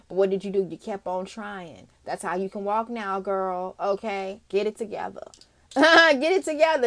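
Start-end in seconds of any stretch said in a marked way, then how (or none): 5.7-6.9 louder, higher pep-talk push; laugh, then urge them loudly; emphatic command. Real, not acted. Repeats the command more forcefully.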